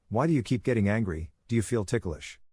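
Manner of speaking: ordinary delivery, nothing marked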